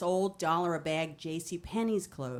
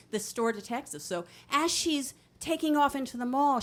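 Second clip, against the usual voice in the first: ordinary talk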